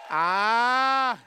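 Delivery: long, held "ah" gliding upward; praise cry. Real, not acted. Preacher calling for praise right now, praise cry. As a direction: hold out a swelling cry.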